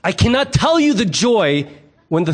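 ordinary talk

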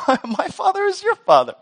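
high, squeaked mock protest; indignant correction. Sibling pointing out they share parents, high mock-indignant voice. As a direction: push the pitch right up, mock-incredulous.

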